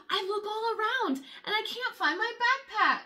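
ordinary delivery, nothing marked

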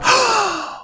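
high startled gasp; quick shocked intake; sharp gasp. Acted. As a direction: gasp at the discovery. Spots something suspicious.

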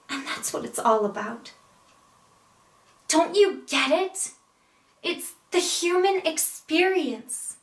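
earnest, wounded pleading; acted emotional appeal, pitch rising on the challenges; Amy monologue. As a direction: plead with a lover, pausing between thoughts. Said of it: "I will be portraying Amy".

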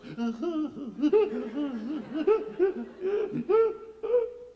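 high, loud mock sobs; convulsive sobbing. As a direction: imitate a broken, embarrassingly convulsive cry. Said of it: "absolutely broken, embarrassingly convulsive kind of cries".

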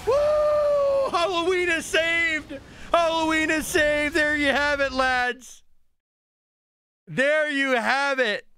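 high, drawn-out victory shout; triumphant cheering. Real, not acted. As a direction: proclaim the win slowly at a high pitch. Celebrates winning the game.

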